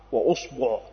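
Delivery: أصبع with damma on the hamza and damma on the ba, uṣbuʿ; vowel demo. Shown as a demonstration. Teacher listing the variant for students, careful uṣbuʿ. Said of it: "ضم الهمزة".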